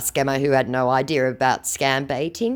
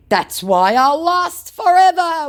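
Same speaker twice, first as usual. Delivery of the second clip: high, loud, slow declaration; mock scammer boast; 8 dB louder, slower than usual. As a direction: ham up a grandiose scammer.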